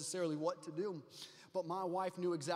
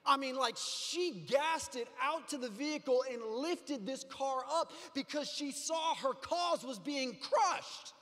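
loud, high, rising sermon cadence; full-force preaching; 6 semitones higher, 4 dB louder than usual. Acted. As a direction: preach it to the back row with fire. Pastor preaching to congregation.

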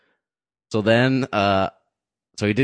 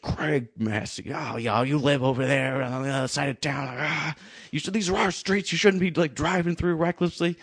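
higher-pitched imitation of a scolding local; mock indignant driver; 7 semitones higher than usual. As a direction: act out an irate man's disdain. Angry driver reads license, retold.

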